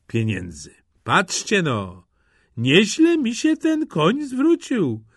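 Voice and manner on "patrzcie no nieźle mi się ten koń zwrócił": slow, drawn-out self-satisfied musing; leisurely gloating aside; pleased talking to himself. A narrator's reading. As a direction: muse slowly to yourself, lifting high at the end. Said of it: "powiedział do siebie".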